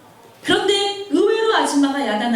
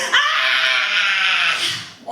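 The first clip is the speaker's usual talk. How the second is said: put-on child's crying scream; 4 semitones lower than usual. Scream of the boy whose mother went shopping. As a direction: wail like a furious five-year-old. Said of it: "소리를 지르고 울면서".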